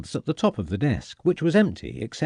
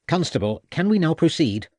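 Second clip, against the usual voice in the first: irritated; 6 semitones higher than usual. A narrator's reading. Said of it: "said Summersby in an irritated voice".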